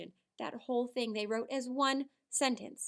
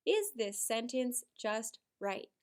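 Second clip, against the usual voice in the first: slow question; slower than usual. Shown as a demonstration. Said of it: "Let's ask ourselves that together".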